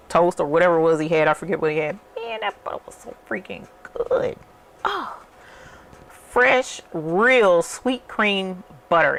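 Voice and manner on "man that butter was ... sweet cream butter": raving. Real, not acted. Raving about tasted butter.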